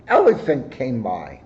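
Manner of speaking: ordinary delivery, nothing marked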